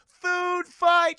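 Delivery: high, loud, drawn-out call; rallying mock shout. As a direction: yell it across a lunchroom, stretching each word. Acts out Paul's rallying cry.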